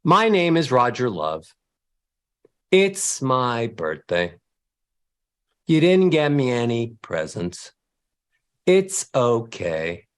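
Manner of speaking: droop every phrase down like you're let down; mock-sad demo with descending melody. Shown as a demonstration. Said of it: "you sound sad".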